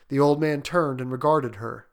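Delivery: ordinary delivery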